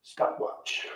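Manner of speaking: faint unvoiced whisper; whispered command. Real, not acted. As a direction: breathe the word quietly to the phone. Voice command to phone.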